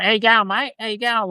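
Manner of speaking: crass, high-pitched broad Australian twang; broad Aussie accent. Retold or imitated. Australian streamer mimicking the crass screen Aussie accent, broad and high. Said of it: "whenever I hear an Aussie accent on T V or movies".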